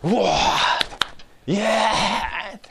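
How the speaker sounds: slow, cry-like cheer from the congregation; imitating ecstatic cheering. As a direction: mimic the overjoyed Costa Mesa churchgoers. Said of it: "って言ったらですね".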